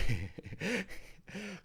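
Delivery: high giggle fit. Real, not acted. Cracking up at name.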